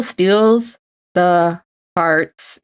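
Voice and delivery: very slow drawn-out words; slow syllable count. Shown as a demonstration. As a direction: tap out each syllable. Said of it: "that's one syllable".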